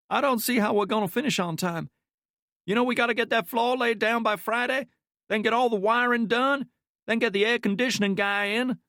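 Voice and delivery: a worker moans about deadlines to his partner, high-pitched; strangely high-pitched. A narrator's reading. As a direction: squeeze the worker's complaint up high. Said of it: "his voice strangely high-pitched for such a big man".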